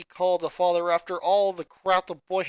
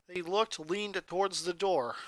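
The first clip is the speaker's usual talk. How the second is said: ordinary delivery, nothing marked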